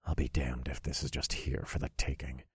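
quiet, suspicious growl; wary, hushed growl; low growl. A narrator's reading. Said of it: "I growled as I looked around".

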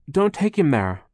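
lightly acted urgent warning; alarmed. Violet warns Charles off the doctor, alarmed.

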